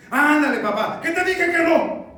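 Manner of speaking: put-on high, loud parent-child clash; quarrelling shout. Child insists and father refuses, shouting. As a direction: yell it, exasperated.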